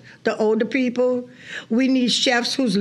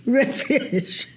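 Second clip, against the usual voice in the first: usual speaking voice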